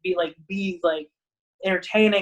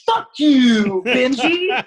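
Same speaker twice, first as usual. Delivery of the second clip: loud, higher put-on curse, breaking into a laugh; mock outburst; 4 semitones higher, 7 dB louder, much slower than usual. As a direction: bark it as a joke at a student. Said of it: "He's like".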